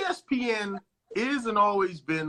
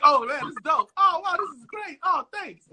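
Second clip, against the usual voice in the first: high, cheerful, a bit louder; mock delighted fan reaction; 9 semitones higher, 5 dB louder than usual. A star thanking fans, high and delighted. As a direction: play the flattered star soaking it up. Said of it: "you kinda like".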